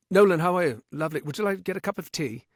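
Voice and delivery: brisk, lilting British accent; polite British accent, quick and light; British friend impersonation. Shown as a demonstration. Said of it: "it's an impersonation of one of my friends".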